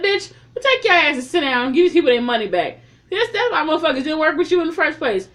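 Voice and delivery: high, loud, fast scolding; yelling at screen. Real, not acted. Yelling at Mickey on screen, high, loud and fast. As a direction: tell her off hard and fast. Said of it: "yelling at the screen".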